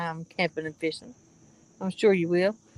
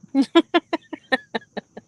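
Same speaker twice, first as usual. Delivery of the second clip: laughing; 5 semitones higher, 4 dB louder than usual. Real, not acted. Laughing at own impression.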